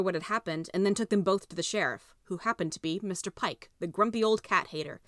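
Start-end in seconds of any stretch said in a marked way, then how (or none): none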